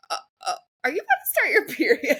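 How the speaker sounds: fast, fumbling question through laughter; awkward trainer. Retold or imitated. Retelling the trainer's awkward question, laughing. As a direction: ask it flustered and quick. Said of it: "he goes".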